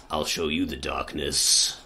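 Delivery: low, slow menacing threat; sinister shadow voice. Pretend. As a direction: growl it like a dark spirit.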